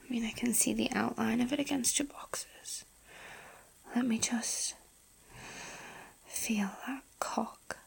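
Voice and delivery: breathy close-mic whisper. Acted. Whispered intimate roleplay throughout.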